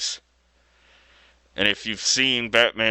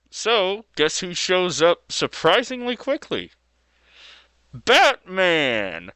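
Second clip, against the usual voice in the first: excited reveal; 9 semitones higher than usual. Real, not acted. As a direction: pitch jumps way up on the teasing question. Teasing reveal of Batman.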